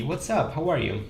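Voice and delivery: ordinary talk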